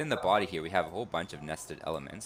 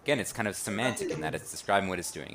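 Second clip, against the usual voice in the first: ordinary talk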